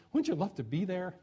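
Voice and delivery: ordinary talk, nothing marked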